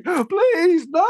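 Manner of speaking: high, wailing imitation of panic; mock pleading. Couples sat behind the fight beg, high mock-panicked plea.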